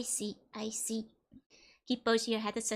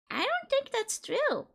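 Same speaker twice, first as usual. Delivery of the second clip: high squeaky denial; 11 semitones higher, slower than usual. Pretend. Denying blame, squeaky slow.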